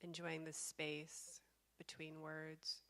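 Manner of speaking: slow, calm meditation guide with long pauses; guided meditation. Real, not acted. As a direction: guide them slowly into stillness, leaving space between phrases.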